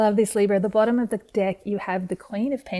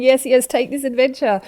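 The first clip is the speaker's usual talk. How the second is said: higher, louder excited cheer-on; eager urging card; 5 semitones higher, 7 dB louder than usual. Acted. Tarot reader voicing the card urging Libra onward, eager. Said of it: "this Four of Wands is saying".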